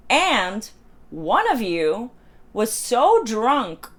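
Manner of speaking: very slow, emphatic scolding; drawn-out accusation. Retold or imitated. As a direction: imitate a scolder spacing out each word.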